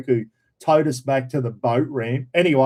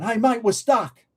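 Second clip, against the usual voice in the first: raised-pitch shout-out at normal volume; mock calling out; 7 semitones higher than usual. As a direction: act out flagging down a passer-by. Hailing a passing boat, retold.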